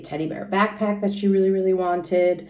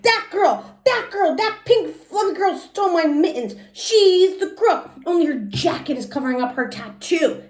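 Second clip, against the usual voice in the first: put-on high, loud, pointing kid voice; excited accusing; 12 semitones higher, 4 dB louder than usual. Junie telling the teacher the girl stole her mittens, high and loud. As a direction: shout it out jumping up and down.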